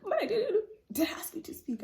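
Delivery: high laughing back-and-forth; giggly mock quarrel; laughing retort. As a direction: laugh while acting the spat. Retold quarrel with teacher.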